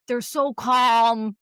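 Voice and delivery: slow, soothing therapist calm; drawn-out calm like a therapist. Shown as a demonstration. Friend describing therapists, slow calm. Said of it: "His tone of voice".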